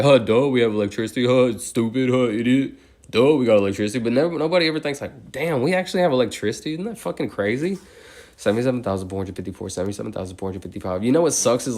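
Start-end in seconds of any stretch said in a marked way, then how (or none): 0.0-4.0 loud, dopey scoffing; dumb mocking voice. Mocking dismissive people. 5.3-7.8 high, slowing awe at the end; amazed wonder. Retold or imitated. Imagined amazed thinker.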